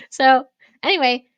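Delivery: usual speaking voice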